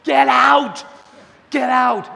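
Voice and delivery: high, loud yell; screaming at the screen. Retold or imitated. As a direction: shout a warning at the movie heroine. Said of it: "we're all screaming at the television".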